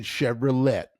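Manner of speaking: "Chevrolet" slow and loud, ending in LET. Shown as a demonstration. Admitting his months-long mistake, mispronounced Chevrolet. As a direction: sound the final T in "Chevrolet". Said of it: "There's a T at the end".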